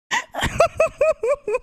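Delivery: high squeaky giggle; a sudden high-pitched giggle. Real, not acted. Laughs at bush joke.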